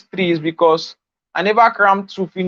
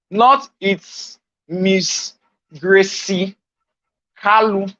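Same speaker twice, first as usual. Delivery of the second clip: slow, affected show-off name; mocking a stylish Facebook name; much slower than usual. Shown as a demonstration. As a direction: drawl it like a fancy nickname. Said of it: "We know you want to act stylish".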